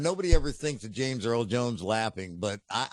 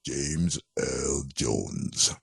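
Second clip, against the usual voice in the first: very deep, very slow voice; James Earl Jones impression; 12 semitones lower, much slower than usual. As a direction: drop low and slow like a movie villain.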